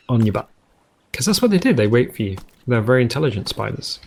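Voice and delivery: ordinary delivery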